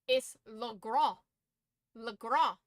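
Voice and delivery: Le Grand with a French nasal ending, no j sound; Le Grand, nasal French vowel at the end. Shown as a demonstration. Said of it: "It's not LaGrange".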